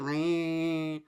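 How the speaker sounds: whining saw noise; drawn-out mock chainsaw buzz; imitated chainsaw. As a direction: rev it like a chainsaw by mouth.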